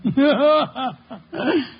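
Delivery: short high amused chuckle; a pleased little laugh into the phone. Acted. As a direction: laugh at the inspector's blunder. Wolf amused at Kramer's mishap.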